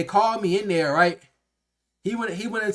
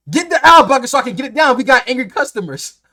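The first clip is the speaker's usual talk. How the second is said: much higher and louder, barking the order; mad yelling boss; 8 semitones higher, 11 dB louder than usual. Retold or imitated. Boss yelling at him about angry customers, loud and high. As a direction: retell the boss yelling at full volume. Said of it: "Then he got mad".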